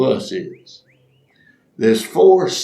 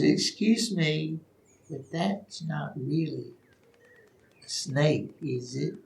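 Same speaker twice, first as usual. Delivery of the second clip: hushed, halting, very soft question; small hesitant voice with long pauses; timid old lady; 3 semitones higher, 12 dB quieter than usual. Retold or imitated. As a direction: ask slowly and softly, nervous of the answer. Timid old lady asks manager.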